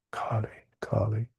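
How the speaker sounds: a soft, breathless whisper of Kali, Kali. Shown as a demonstration. Teacher invokes the goddess to his listeners, whispering.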